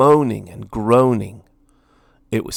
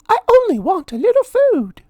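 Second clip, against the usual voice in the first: high, louder mouse voice pleading; Minnie pleading; 7 dB louder than usual. A narrator's reading. Minnie begging Lord Gurr, high pleading mouse voice. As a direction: beg sweetly for food.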